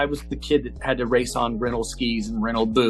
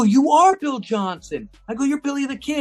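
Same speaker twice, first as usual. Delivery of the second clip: starstruck surprise; 12 semitones higher, 4 dB louder than usual. Retold or imitated. Teen realizing the stranger is the Olympic champion, high amazed surprise. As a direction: realize you're facing your hero.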